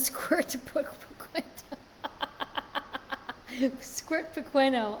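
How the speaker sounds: breaking up mid-word into breathless giggles; laughing. Real, not acted. Host fumbles squirt pequeno, helpless laughing. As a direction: try to get the words out through laughter. Laughs while attempting the words.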